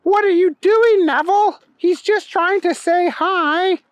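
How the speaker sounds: put-on high-pitched protest from a little girl; little girl crying out. A narrator's reading. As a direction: cry out in a high child's voice. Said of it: "the girl, cried out".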